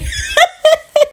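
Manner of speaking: high giggle after her own joke; amused, high chuckle; real laugh. Real, not acted. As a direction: burst into a light, high-pitched laugh.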